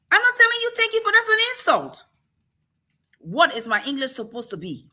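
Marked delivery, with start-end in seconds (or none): 0.1-2.0 much higher, louder and fast, bristling; indignant outburst. Real, not acted. Rejecting a backhanded compliment on her English, indignant and fast. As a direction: fire it back, offended and quick.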